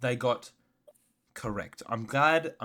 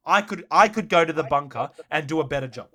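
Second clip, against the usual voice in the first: frustrated rant; 3 semitones higher, 7 dB louder than usual. Real, not acted. Ranting about referees.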